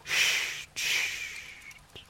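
a breathy whispered chut to calm his wife; soothing hush. A narrator's reading. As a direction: hush her gently to sleep. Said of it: "fit-il d'une voix apaisante".

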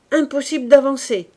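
loud exasperated cry; exclaiming. Acted. Corporal crying out at the jammed streets, loud. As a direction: shout it over the blocked street. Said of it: "s'écria le caporal".

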